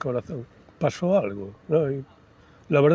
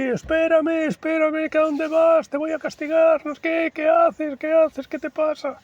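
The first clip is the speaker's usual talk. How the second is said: high-pitched frantic yelling mother; mother shouting as she chases her son uphill; 4 dB louder than usual. Retold or imitated. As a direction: shout after the child, scolding and scared. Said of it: "Mi madre detrás gritando allí".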